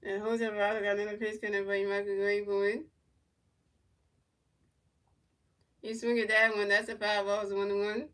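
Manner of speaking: lightly voiced broadcaster calling the at-bat; mock play-by-play. Fan calling the game live to stream viewers, mock broadcaster. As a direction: narrate each pitch like a TV booth.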